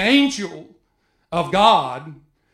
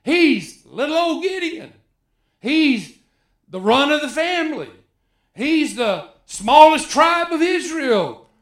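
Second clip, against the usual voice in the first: high, pleading little-man voice; a hint of a whiny Gideon putting himself down; Gideon's self-doubt; 7 semitones higher, 3 dB louder than usual. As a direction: voice him as small and unworthy, pitched up. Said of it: "That's what he would say".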